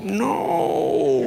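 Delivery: long, loud, high-pitched wail of no; mock dismay. Congregation watching him walk out, mock dismay. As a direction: stretch it out in hammed-up horror.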